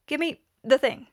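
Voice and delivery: impatient demand for the guide. A narrator's reading. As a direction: snap out the order. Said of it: "Tony demanded".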